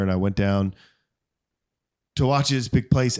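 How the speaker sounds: ordinary talk, nothing marked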